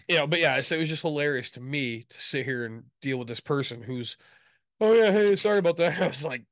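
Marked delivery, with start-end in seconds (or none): 4.8-6.0 high, loud mock apology; put-on high apologetic agent voice; customer service agent. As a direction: imitate a phone agent breezing back on the line. Imitates agent returning from hold.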